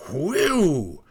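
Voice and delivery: high, falling whoop; short gusting "whee-oo"; wind howl. A narrator's reading. Snow boy howls like wind.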